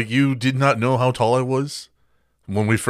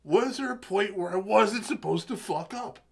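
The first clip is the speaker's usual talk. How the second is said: high mock worried voice; 10 semitones higher than usual.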